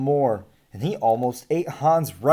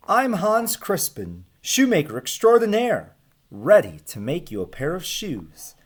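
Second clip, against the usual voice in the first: proud Hans; 6 semitones higher than usual. Acted. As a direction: announce yourself grandly to the giant. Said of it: "said Hans".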